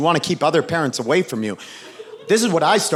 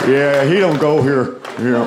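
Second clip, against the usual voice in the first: Buffalo Bill; 3 semitones lower than usual. Retold or imitated. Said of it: "taking on that voice like Buffalo Bill".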